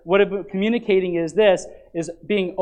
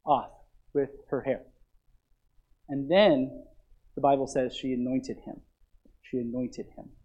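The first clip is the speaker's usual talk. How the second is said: hushed tender preaching; 6 semitones lower, 10 dB quieter than usual. Real, not acted. Tender climax of the anointing story.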